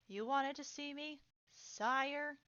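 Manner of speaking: a touch of irritation, rising on sire; mildly irked, grudging question to a superior; irritated. A narrator's reading. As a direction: say it with restrained annoyance. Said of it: "saying sire in an irritated voice".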